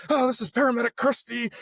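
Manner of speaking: high-pitched frantic self-announcement; worked-up retold outburst, pitched high; going off the rails. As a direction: imitate yourself babbling into an emergency call. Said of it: "I'm going off the rails".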